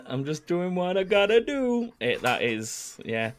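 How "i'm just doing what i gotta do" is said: higher-pitched, lightly put-on quote; mimicked quote. Host echoing the contestant's script, lightly put-on quote. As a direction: echo the line in a mild character voice. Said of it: "if you want the full script".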